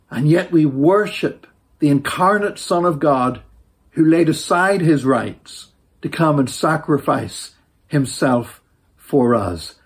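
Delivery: normal talking voice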